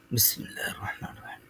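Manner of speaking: soft breathy whisper under the breath; whispered bismillah. Real, not acted. A teacher whispering bismillah to himself before the lesson, whispered. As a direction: murmur the blessing almost silently.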